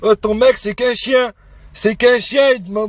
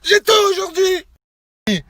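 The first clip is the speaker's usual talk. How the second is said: high-pitched, worked-up outburst; very angry; 6 semitones higher, slower than usual. Real, not acted. Man telling his Snapchat followers he is furious, angry outburst. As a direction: let anger climb into a high cry. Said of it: "quelqu'un qui est très en colère".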